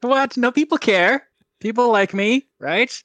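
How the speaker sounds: high, loud mock indignation; mock offended. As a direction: protest like your feelings are hurt. Mock hurt after "no one cares.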